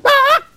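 a piercing, very high and loud raven caw; raven screech. Shown as a demonstration. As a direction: let out a screeching raven cry at the top of the voice. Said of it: "from the top of my voice went".

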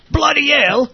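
high, loud, drawn-out fake British; big put-on British outburst; mock British exclamation. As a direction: exclaim like a hammed-up Brit. Joining in mock British phrases.

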